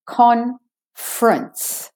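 "Conference" slowly as CON-frence; two-syllable conference. Shown as a demonstration. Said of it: "from the F to the R".

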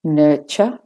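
British-Australian "Nurture" with a final schwa. Shown as a demonstration. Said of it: "no R in the U R".

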